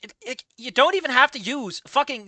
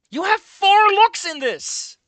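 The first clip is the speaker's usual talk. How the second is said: much higher, louder, slow complaint; exasperated rant; 10 semitones higher, 6 dB louder, slower than usual. Real, not acted. Reviewer ranting at the overused word. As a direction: hammer the complaint home.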